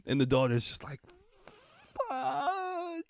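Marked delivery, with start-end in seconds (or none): 2.1-3.0 thin, very high drawn-out squeal; mock high-pitched despair; mortified wail. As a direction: imitate a daughter wailing in embarrassment. Voicing the daughter's horrified reaction.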